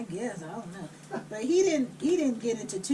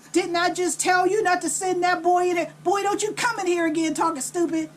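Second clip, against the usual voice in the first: mock scolding, higher and louder; scolding mom; 5 semitones higher, 4 dB louder than usual. Mom scolding daughter for sending the boy in, mildly raised voice. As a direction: imitate an annoyed mother telling someone off.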